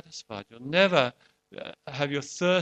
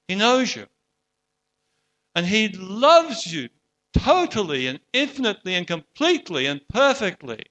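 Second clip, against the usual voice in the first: heartfelt preaching; 3 semitones higher than usual. Acted. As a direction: press the love home, building to the end. Closing appeal on God's love.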